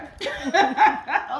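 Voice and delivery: loud amused laugh; hearty laugh at her own jab; real laugh. Real, not acted. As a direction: burst out laughing at yourself.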